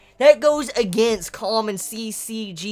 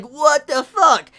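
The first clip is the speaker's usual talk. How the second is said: louder, slowed-down shocked exclamation; a second, less shrill what the fuck; surprised what the fuck; 7 semitones higher, 8 dB louder, much slower than usual. Retold or imitated. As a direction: repeat the disbelief, slightly calmer. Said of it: "my reaction was like".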